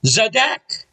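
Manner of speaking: mechanic's high-pitched exclamation; pitched high and rising, an exclamation; exclaimed. Retold or imitated. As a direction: exclaim it as a triumphant one-word verdict. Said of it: "and exclaimed".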